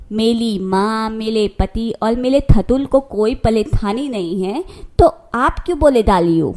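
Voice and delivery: put-on childlike lisp, r turned to l; indignant lisp. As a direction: answer back with a tongue-tied lisp. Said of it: "अरे ठीक से बोल तोतली". (चिढ़कर तुतलाते हुए)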